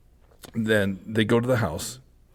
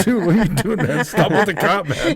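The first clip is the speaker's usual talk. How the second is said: higher, louder, laughing yell; laughing mock shout, pitched up; mock yell at cameraman; 8 semitones higher, 4 dB louder than usual. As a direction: call out to the cameraman in disbelief. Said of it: "I'm like".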